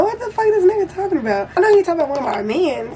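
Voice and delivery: baffled. Retold or imitated. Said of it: "I'm like".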